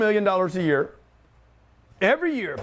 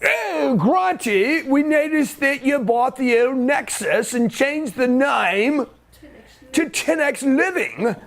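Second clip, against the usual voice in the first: higher, slow fawning banker voice; mock banker; 6 semitones higher, much slower than usual. Bank praising Grant's new purchase, hammed-up and slow. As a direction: flatter him like a bank that wants his business.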